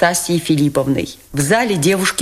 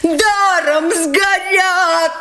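roaring; 14 semitones higher, much slower than usual. A narrator's reading. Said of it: "ревели со всех сторон".

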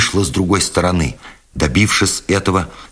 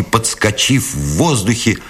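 ordinary delivery, nothing marked

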